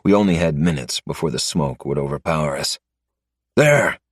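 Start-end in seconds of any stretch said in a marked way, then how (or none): 3.5-4.0 a sharp, high call; pointing out the weak wall with a loud cry; sudden shout. Acted. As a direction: shout it as you spot the escape. Spotting the way out.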